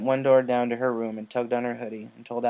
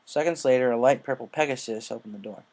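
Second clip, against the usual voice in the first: plain reading voice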